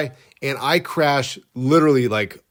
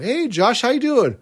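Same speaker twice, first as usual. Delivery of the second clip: cheery, much higher mom on the phone; mom's voice; 11 semitones higher than usual. Retold or imitated. Mother answering her son's call, high cheery mom voice. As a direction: greet him brightly like his mother. Son calls his mom.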